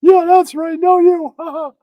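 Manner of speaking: high, loud catchphrase shout; goofy catchphrase. Acted. Comic shouting his catchphrase at the audience, high and loud. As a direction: bark the catchphrase like a cartoon.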